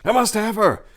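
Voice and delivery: high loud lust-filled declaration; obsessive cry. Acted. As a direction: blurt it out, consumed by desire. Prince obsessed with dancer.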